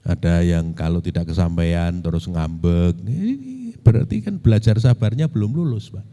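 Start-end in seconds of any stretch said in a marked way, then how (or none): none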